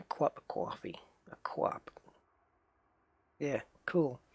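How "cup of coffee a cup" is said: softly practised New York "cwop a cwoffee"; New York accent. Shown as a demonstration. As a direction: try the New York accent quietly to yourself. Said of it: "it is New York".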